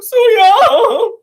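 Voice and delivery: put-on high, slow, cried-out Sue Young; imitating the daddy. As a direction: wail the name like the daddy did. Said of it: "how the daddy said it".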